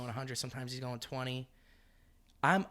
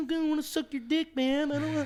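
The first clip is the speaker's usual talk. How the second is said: high, loud put-on voice; hammed-up angry whine, high; mock outburst; 6 dB louder than usual. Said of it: "I used to say that to him".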